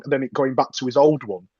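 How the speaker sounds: ordinary delivery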